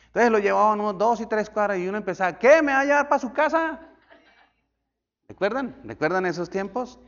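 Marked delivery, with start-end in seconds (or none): 2.4-3.8 high, loud mock indignant question; surprised, higher and louder; mock surprise. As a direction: act out a startled passenger. Mock passenger surprised at ride.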